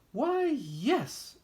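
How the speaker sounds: very high, delighted yes; happy mouse voice. Acted. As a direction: answer brightly in a high voice. Mistress Mouse accepts.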